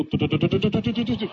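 fast "嘟嘟" patter for quick steps; footsteps sound. Acted. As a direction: rattle off "嘟嘟" like running feet. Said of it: "走道的声音".

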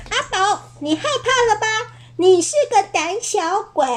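很高的小妖怪嗓音，带笑取笑; 蹦跳着尖声起哄; 唱着嘲笑. Acted. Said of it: "一边跳一边唱". (小妖怪们跳着唱，嘲笑阿宝)

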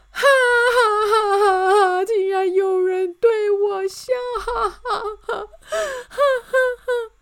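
very high, loud bawling; hammed-up wail through the words. A narrator's reading. King is laughed at, bursts into loud wailing. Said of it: "又放声大哭了".

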